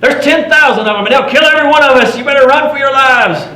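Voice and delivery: high, full-force warning holler; yelling back. Acted. Rider on a runaway horse yelling back to the trappers to run. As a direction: holler it high and urgent over a distance. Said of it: "I just yell back at him".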